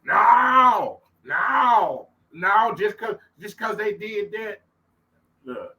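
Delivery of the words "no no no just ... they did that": high, loud mock wail of no no no; hammed-up childish refusal; loud, high mock crying protest. Mocking stubborn refusal.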